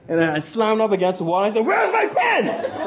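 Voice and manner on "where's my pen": high, slow, half-laughing mock demand; retold demand. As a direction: squeak it out slowly through a laugh, mocking his own anger. Retold confronting friend against wall.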